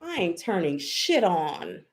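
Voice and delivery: ordinary talk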